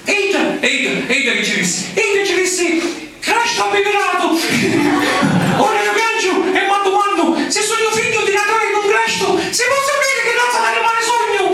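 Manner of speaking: put-on high, wailing little boy; crying child voice. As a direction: sob it out like a small boy.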